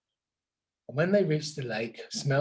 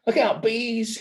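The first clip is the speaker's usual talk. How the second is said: shouting; 7 semitones higher, 3 dB louder than usual. Acted. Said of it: "he shouted".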